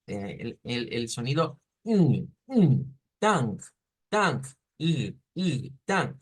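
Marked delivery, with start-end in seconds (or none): 1.8-3.0 "ng" as an isolated nasal hum; nasal sound. Shown as a demonstration. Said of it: "el sonido nasal por excelencia". 3.2-4.4 "tang" with a nasal ng ending; nasalized. Shown as a demonstration. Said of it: "el sonido nasal por excelencia".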